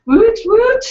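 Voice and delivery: high, loud little cheer; excited cheer. Real, not acted. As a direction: cheer it out, celebrating. Cheering a high word count.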